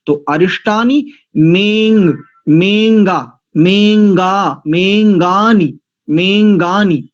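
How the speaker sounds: मेंगानी drawn out slowly, ng joined; slow drill of मेंगानी; correct pronunciation. Shown as a demonstration. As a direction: build up मेंगा, मेंगानी syllable by syllable. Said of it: "ये शुद्ध उच्चारण होगा".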